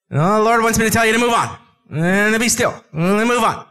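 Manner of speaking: hammed-up confused Moses; mock flustered messenger. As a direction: rush the mixed-up message. Moses relays confused orders.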